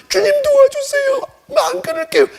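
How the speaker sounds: high, squealed begging; mock pleading. Stubborn believer begs the Lord for help, high mock plea. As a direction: beg in a very high, hammed-up voice.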